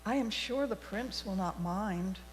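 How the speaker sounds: usual speaking voice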